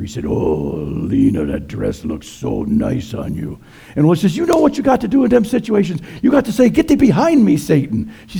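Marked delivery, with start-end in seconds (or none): 0.2-3.6 lower, slow, seductive devil; a low, slow tempter purring in her ear; the devil flatters Lena, lower and slow; devil whispering. Retold or imitated. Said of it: "the devil just whispered in my ear". 4.4-8.1 much higher, louder and fast pastor; hammed-up high-pitched lecturing; Ole, preachy. Ole gives pious advice.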